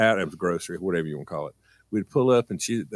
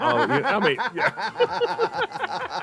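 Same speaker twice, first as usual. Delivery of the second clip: laughing; much slower than usual. Real, not acted. Laughing after story.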